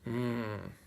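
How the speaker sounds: ordinary delivery, nothing marked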